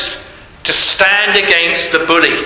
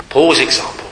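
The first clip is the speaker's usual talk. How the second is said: ordinary delivery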